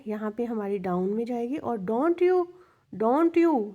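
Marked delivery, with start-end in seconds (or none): 1.8-3.7 don't you lifted high and louder; rising tag tune. Shown as a demonstration. Teacher demonstrates the question tag rising for students. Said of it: "don't you जब आएगी तो rising intonation है".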